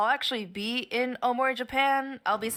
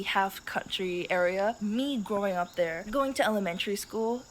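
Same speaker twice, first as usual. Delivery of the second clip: ordinary talk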